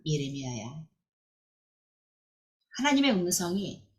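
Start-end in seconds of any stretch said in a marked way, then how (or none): 0.1-0.9 a hint of a low, soft divine call; voice of God calling. Preacher voicing God calling young Jeremiah, low and soft. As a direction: call his name low and hushed, as God. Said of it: "하나님의 음성을 듣습니다".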